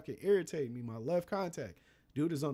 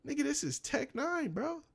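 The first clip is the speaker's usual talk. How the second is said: surprised realization; 10 semitones higher than usual. Retold or imitated. Recognizing the famous rapper.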